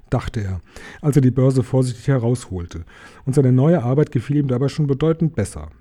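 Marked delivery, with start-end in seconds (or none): none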